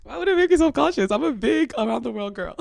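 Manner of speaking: high, loud mock-indignant protest; playful protest. Real, not acted. Friend brushing off worry about making them self-conscious, playful protest. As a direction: protest loudly with a laugh creeping in.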